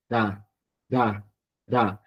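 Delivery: "Da, da, da" as soft single taps, like a struck L; tapped R, L-like. Shown as a demonstration. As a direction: say each "Da" softly with one tongue flick. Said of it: "pero golpeándola".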